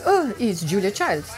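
lightly imitated French accent; mother's French accent. French mother revealing who the friend is, imitated French accent. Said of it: "she has this very strong French accent".

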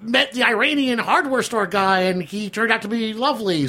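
parodying a Times diarist, high mock fright; mock terrified writer. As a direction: play the timid diarist, over the top.

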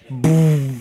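drawn-out "boom" sound effect; a long, humming boom of shock; imitated boom. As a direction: mimic being hit by a blast. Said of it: "Nastasia and I were like".

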